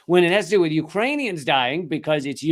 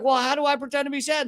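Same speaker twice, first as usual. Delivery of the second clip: mocking clueless official; 11 semitones higher, faster than usual. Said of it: "he's like".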